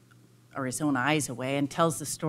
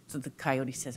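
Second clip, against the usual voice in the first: ordinary talk, nothing marked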